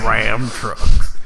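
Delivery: low, loud drawling truck ad voice; lightly voiced Sam Elliott; Sam Elliott impression. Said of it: "You're gonna talk like Sam Elliott".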